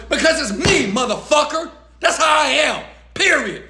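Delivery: high, forceful comeback; defiant retort. Acted. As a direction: throw it back at the critics, unapologetic. Acted defiant retort to complaining critics.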